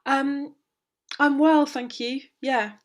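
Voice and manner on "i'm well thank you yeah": ordinary talk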